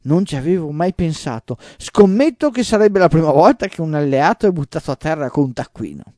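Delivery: loud, high playful astonishment; mock amazement. A narrator's reading. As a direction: marvel at the idea, hamming it up. Don Juan mock amazement, laughing.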